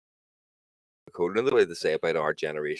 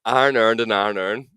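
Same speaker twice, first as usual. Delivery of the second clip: Northern Irish accent; 3 semitones higher, 6 dB louder than usual. Shown as a demonstration. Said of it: "go say it again".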